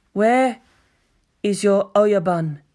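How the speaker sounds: slow, cold and menacing question; icy threat to a terrified thug; cold hostility. A narrator's reading. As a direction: chill them with each word. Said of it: "it asked with cold hostility".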